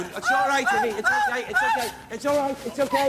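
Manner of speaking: high, loud, shaken comforting; calming someone while scared yourself; frantic reassurance. Real, not acted. Medium urgently calming Ivy after the crash, high and loud.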